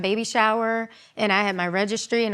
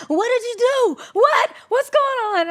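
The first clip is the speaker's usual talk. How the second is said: high frantic mother yelling; panicked mom hollering; 4 dB louder than usual. Retold or imitated. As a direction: mimic a mom shrieking in panic. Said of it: "she was hollering".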